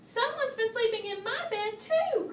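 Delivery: a cub bursting with news; excitedly. A narrator's reading. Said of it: "cried Baby Bear excitedly".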